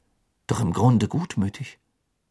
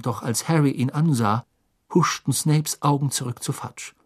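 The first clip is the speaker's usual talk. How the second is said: usual speaking voice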